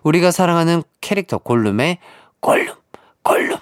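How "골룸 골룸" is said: hissing whispered Gollum impression; creepy creature whisper, slow on precious; Gollum quote. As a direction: whisper it like Gollum stroking his ring. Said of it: "이런 명대사가 있죠".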